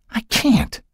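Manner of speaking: high, fearful protest; lightly voiced frightened girl. A narrator's reading. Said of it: "Becky protested".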